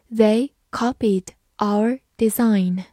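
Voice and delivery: "They copied our design" slowly, each word set apart; slow reading. Shown as a demonstration. Teacher reads the sentence for learners, slowly. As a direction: read word by word, slowly, with gaps. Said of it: "好，慢慢来读".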